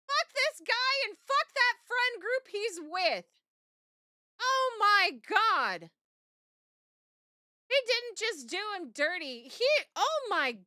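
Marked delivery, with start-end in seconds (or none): 0.1-3.2 high, slow furious shout; furious outburst. Real, not acted. Streamer furious at cheating story. 4.4-5.9 high drawn-out exclamation; shocked. Real, not acted. Reacting to betrayal story.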